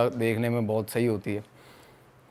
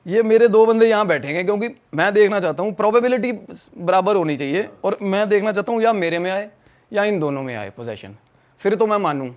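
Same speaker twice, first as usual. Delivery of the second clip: insistent retelling; 6 semitones higher, 5 dB louder than usual. Retold or imitated. Insisting to friends before ouija.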